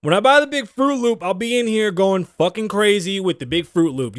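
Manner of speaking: ordinary delivery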